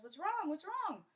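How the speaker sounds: high, loud, urgent questions; alarmed questioning. Acted. Father comes running at the noise, alarmed questioning. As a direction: demand to know what happened.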